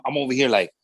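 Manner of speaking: ordinary talk, nothing marked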